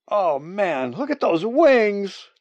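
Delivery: loud, high, drawn-out exclamation; yelling. Acted. Human yelling at the sight of huge wings, amazed.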